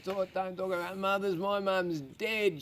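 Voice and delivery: mock-upset audience member; upset heckler. Acts out upset heckler in crowd.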